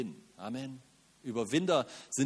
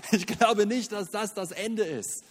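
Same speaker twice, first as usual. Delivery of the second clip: preaching at full force; 3 semitones higher, 4 dB louder than usual. Acted. Preacher proclaiming faith loudly.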